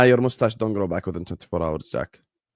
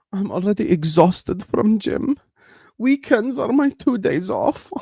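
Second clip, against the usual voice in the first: mocking whiny voice; 10 semitones higher, slower than usual.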